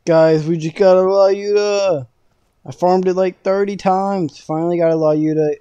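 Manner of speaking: loud, high excited shout; thrilled and loud, easing at the end; excited announcement. Real, not acted. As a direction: yell the great news to viewers. Celebrates rare weapon drop.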